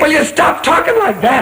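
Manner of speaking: snap, he can't take it anymore; desperate outburst. Acted. Repeats plea, more desperate.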